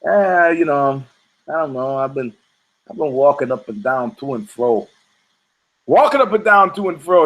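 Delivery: a lightly voiced lazy Satan, low and offhand; Satan's voice. Satan shrugging off God's question, lightly voiced. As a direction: shrug it off like a bored devil.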